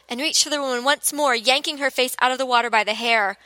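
usual speaking voice

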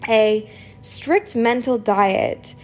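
normal talking voice

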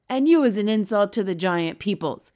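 loud, angry giant's shout; shouted insult. A narrator's reading. Blood bottler answers the B F G, shouting. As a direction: shout the accusation at him. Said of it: "shouted the blood bottler".

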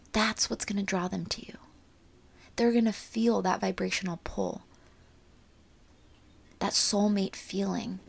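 ordinary delivery

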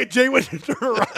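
normal talking voice

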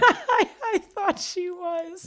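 high, slow words through laughter; laughing. Real, not acted. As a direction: laugh it off, embarrassed. Reader laughs at teasing.

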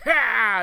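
high drawn-out theatrical "ha"; mock hex thrown at someone; hammed-up villain curse yelp; curse cry. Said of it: "when he curses somebody".

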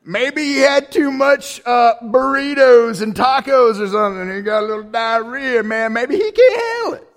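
lightly voiced jeering mockery; mocking taunt. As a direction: tease them like a schoolyard bully. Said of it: "That's embarrassing".

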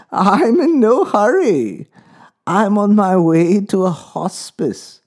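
high, slow frail lady voice; calm old lady facing the end; old woman voice. A narrator's reading.

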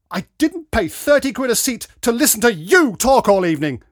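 loud, high-pitched indignant complaint; angry. A narrator's reading. Dad scolding talkers at a concert, angry. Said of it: "said Dad angrily".

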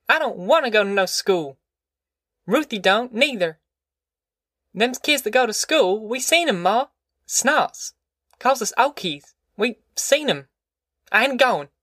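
put-on high, sulky boy's voice; stubborn boy. A narrator's reading. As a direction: dig in like a defiant kid. Boy refuses school.

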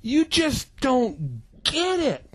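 mild mock exasperation; exasperated. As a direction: drag it out in frustration. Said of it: "he was like".

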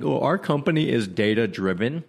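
lightly put-on corporate boasting; mock company spokesperson. Company bragging about being data-driven, lightly mocking voice. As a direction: lightly parody a self-satisfied company line. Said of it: "I'll always hear things like".

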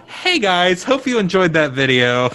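high-pitched mock vlogger sign-off; YouTuber outro. Host mocking his own future outro, high vlogger voice. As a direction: chirp it like a parody YouTuber. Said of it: "record an outro that's like".

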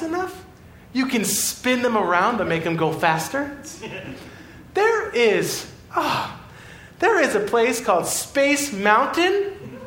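put-on can you believe this kid, bursting with it; mock-outraged preacher listing Disneyland joys to a boy; incredulous. Incredulous rant at the little boy.

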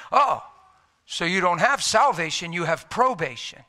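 loud, pouncing mock gotcha; gotcha punchline. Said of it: "hit me with his punchline".

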